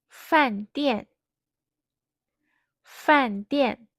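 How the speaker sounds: each syllable falls quickly from high, from 大概 to 上课; fourth tone. Shown as a demonstration. Teacher modelling for learners to repeat, quick falling fourth tones from 大概 to 上课. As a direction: say 大概, 饭店, 电话, 散步, 电视, 上课 with sharp downward pitch on both syllables. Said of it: "These words are made of a fourth tone".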